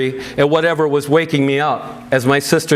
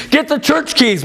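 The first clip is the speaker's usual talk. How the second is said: much higher, commanding call; urgent order; 10 semitones higher than usual. A narrator's reading. Sisters ordering their mother to find the keys, urgent and much higher. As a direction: bark out the order. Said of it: "my sister and I ordered".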